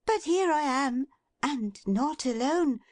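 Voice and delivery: put-on high, smiling young girl's voice; teasing her father with good news; smiling Meg. A narrator's reading. Meg teases Trotty about her basket, high and smiling. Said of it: "smiling as she spoke".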